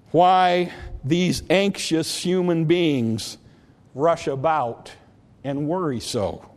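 slow, fading poem reading; poem recitation. Acted. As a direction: robin and sparrow poem read to the church. Recites robin and sparrow poem.

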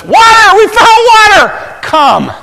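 high, very loud shout across miles; full-out long-distance holler; calling to camp. Acted. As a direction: yell the news, then one big summons. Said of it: "turned back to the camp".

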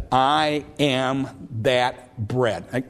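I am the bread, drawn out very slowly with weight on I; emphatic I. Shown as a demonstration. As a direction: lean hard on I, then let life fall away. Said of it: "a Greek emphatic".

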